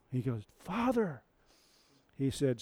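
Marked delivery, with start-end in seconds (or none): 0.6-1.2 a higher, longing call; Simba's cry. Retold or imitated. Simba calling to his father in the clouds, higher. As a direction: reach up toward the sky.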